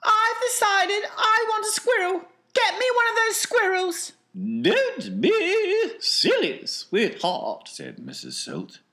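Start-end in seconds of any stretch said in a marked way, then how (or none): 0.0-4.1 high, demanding brat voice; spoilt girl. Acted. Veruca demanding a squirrel. 4.3-7.7 high, slow, indulgent mother voice. Acted. Mrs Salt answers daughter.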